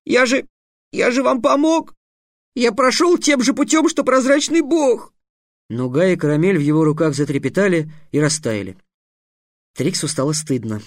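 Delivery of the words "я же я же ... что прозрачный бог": put-on higher boy's voice near tears, slowing at the end; a hurt boy pleading his case; tearful boy. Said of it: "навернулись слёзы".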